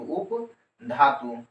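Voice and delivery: उपधातु stretched long and very slow; drawn out slowly. Shown as a demonstration. Teacher drilling उपधातु for the class, very slow. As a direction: lengthen every sound of उपधातु for dictation. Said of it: "इधर क्या बोलने का है".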